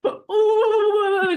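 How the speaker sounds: high, loud wailing laugh-sob; patient breaking down in front of the psychiatrist, high wail; mock sob-laugh. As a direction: imitate yourself bursting into tears. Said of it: "And I'm like".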